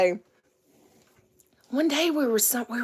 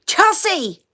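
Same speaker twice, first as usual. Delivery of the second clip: high, raised call to a wife; 8 semitones higher, 3 dB louder than usual. Retold or imitated. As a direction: call out for her from another room. Son calls to his wife.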